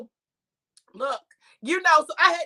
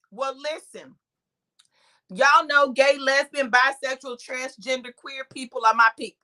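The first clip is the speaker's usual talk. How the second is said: usual speaking voice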